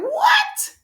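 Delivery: very high, loud squeal on "What"; imitating flabbergasted pupils; mock astonishment. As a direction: squeak out a hammed-up, incredulous what.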